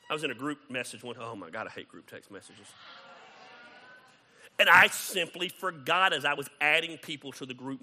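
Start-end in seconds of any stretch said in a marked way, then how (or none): none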